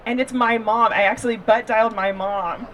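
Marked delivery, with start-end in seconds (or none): none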